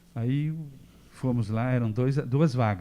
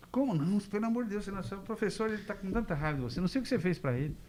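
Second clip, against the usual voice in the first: much higher, soft, worried nurse voice; pleading nurse; 6 semitones higher, 6 dB quieter than usual. Retold or imitated. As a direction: plead with him in a high hushed voice.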